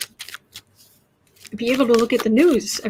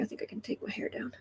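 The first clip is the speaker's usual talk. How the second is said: whispered aside; 15 dB quieter, faster than usual. Real, not acted. Aside while fixing her hair.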